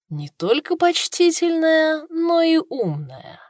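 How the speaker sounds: slow, high, dripping irony; ironic. Acted. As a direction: say it with a sly ironic edge. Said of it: "ясно слышалась ирония".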